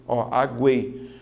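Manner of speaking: aguei said slowly, a-gu-ei, u clearly heard; teacher demonstrating the correct aguei. Shown as a demonstration. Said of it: "aquela letra U é uma semivogal".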